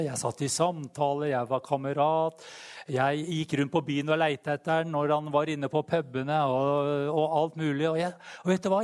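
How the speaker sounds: usual speaking voice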